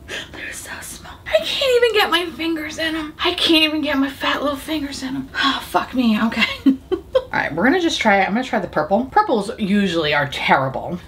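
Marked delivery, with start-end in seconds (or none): 0.1-5.3 whispered complaint, high; whiny whisper. Real, not acted. Whiny whisper at tiny pans.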